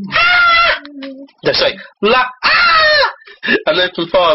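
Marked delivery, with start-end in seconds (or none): 0.1-0.8 sudden comic shriek; full high-pitched captain's scream. Acted. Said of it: "The captain screamed". 2.0-3.1 high shriek after a French article; comic scream, pitched way up; mock French scream. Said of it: "The captain screamed".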